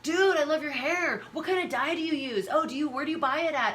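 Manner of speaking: pepper him with eager questions; pushy fan. Retold or imitated. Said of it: "someone who's like".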